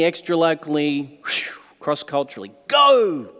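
rally the church like a fiery pastor; full-force preaching. Acted. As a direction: pastor exhorting his congregation to go out.

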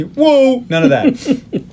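high, laughing protest; joking rebuke through laughter; laughing mock correction. Real, not acted. Host jokingly corrects movie line to friends, laughing.

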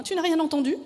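flustered, pitch jumping up; panicked question. A narrator's reading. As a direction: ask it high and worried. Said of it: "Affolée".

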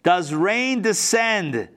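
very slow, drawn out question; slow pointed question. Acted. King questioning Alexander, slow pointed question. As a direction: stretch every word of the question. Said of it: "Listen to this".

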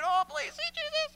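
high squeaky little plea; tiny pleading voice. Pretend. Lone believer pleading alone, high and squeaky. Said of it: "your little grain of salt... saying".

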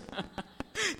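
short high laugh; a quick laugh at the foolish selfie. Real, not acted. Preacher laughing at the man bitten taking a selfie, short high laugh.

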